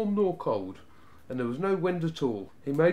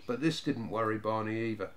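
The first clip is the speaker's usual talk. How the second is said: usual speaking voice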